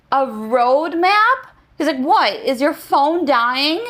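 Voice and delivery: high, slow, incredulous a road map; imitating baffled clerk. Clerk hearing someone wants a paper map, slow and baffled. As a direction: stretch it out in disbelief.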